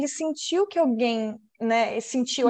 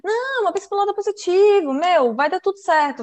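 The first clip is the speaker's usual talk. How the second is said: upbeat optimist; 7 semitones higher than usual. Acted. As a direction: play a relentlessly positive pep-talker. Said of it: "eu sou a joy em pessoa".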